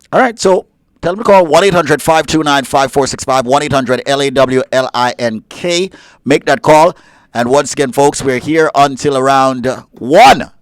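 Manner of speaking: loud radio host pitch; radio call-in plug. Real, not acted. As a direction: punch out the station plug for listeners. Gives out the call-in number.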